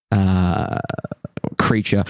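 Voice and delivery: ordinary talk, nothing marked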